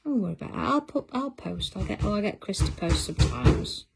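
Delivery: normal talking voice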